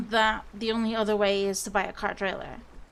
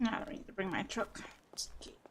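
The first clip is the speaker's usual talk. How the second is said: whispering; 3 semitones lower, 13 dB quieter than usual. Real, not acted. Talking while family near.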